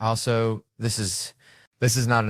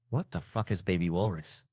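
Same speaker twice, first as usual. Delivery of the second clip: softer, held-back confrontation; subdued demand; 6 dB quieter than usual. Retold or imitated. First asks, low and subdued.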